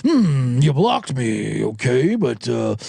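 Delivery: very low, stretched-out slow drawl; Tizzy impression. Host mimics him confronting the woman online, slow deep drawl. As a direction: drag out every word in a deep mock vigilante voice. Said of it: "So he comes in there".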